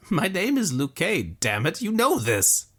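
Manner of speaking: cling to hope for your friend; young Luque, desperate. A narrator's reading. Young man begging his dying friend, high and urgent.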